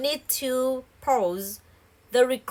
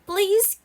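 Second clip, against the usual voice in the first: Please, pitched high and louder, rising; emphatic please; 9 semitones higher, 4 dB louder than usual. Shown as a demonstration. Teacher modelling an eager request, rising. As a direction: lift Please, high like a plea. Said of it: "the last one sentence say".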